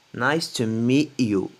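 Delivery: "Nice to meet you." with "meet" said as "mi", the final T dropped; American dropped T. Shown as a demonstration. Teacher showing students the American T drop in "meet". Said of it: "Les Américains prononcent pas le T à la fin".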